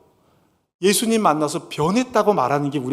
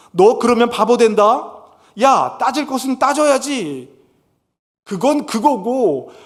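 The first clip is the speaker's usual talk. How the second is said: higher, slow, nagging advice; mock worldly friend scolding; 6 semitones higher, 3 dB louder, slower than usual. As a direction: lecture a friend who is being naive. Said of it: "따지는 거예요".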